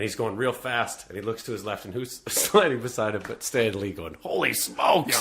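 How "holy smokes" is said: higher-pitched comic exclamation; lightly hammed-up cameo cry; mock astonishment. Stan Lee sliding down the ribbon, mock astonishment.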